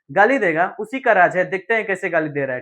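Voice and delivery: usual speaking voice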